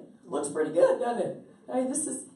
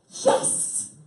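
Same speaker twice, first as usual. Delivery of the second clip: excited shout; 10 dB louder than usual. Retold or imitated. Joyful reaction to good news.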